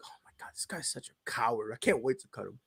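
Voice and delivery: hushed front-office griping; acted scornful undertone; mock whisper. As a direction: whisper it low, behind his back. Front office muttering about player.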